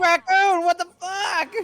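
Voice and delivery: high startled yelp, half laughing; shocked cry. Real, not acted. Sudden shock at cartoon.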